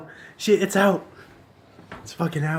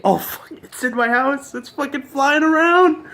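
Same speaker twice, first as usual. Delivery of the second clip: rising panic; 10 semitones higher, 5 dB louder than usual. Real, not acted. Bird suddenly flies around.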